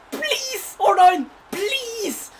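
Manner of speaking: high, loud begging; desperate pleading. Real, not acted. Gamer begging his friend Arnstein for a good card. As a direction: beg the pack for the card. Begging during pack reveal.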